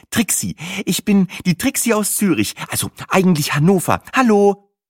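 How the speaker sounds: high, bright greeting; bubbly Trixie. A narrator's reading. Trixie introduces herself cheerfully. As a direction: chirp a hello.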